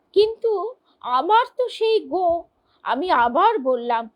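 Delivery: ordinary talk, nothing marked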